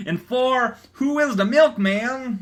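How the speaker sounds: high, showy reading voice; big dramatic question; hammed-up announcement. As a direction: announce it like a quiz host. Reading comic title aloud.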